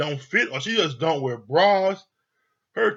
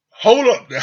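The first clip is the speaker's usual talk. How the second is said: loud, raised imitation of a producer; mock producer calling out, higher and louder; calling a halt; 5 semitones higher, 9 dB louder than usual. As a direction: cut in loudly to stop everyone. Voices producer halting the shoot.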